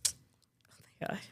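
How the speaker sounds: breathy, near tears; barely voiced, choking up; whispered, overcome. Real, not acted.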